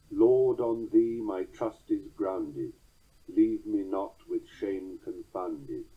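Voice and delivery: slow, strong-weak beats, Lord and trust hit; slow trochaic psalm recital; trochaic reading. Shown as a demonstration. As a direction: lean on each first syllable. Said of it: "written in trochaic tetrameters".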